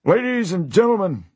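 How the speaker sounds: high, booming showman call; circus ringmaster. Acted. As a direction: announce like a ringmaster. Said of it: "don't forget the spiel".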